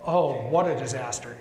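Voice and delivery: usual speaking voice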